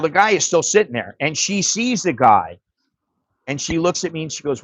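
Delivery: usual speaking voice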